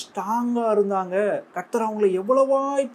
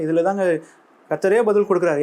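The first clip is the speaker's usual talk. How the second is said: ordinary delivery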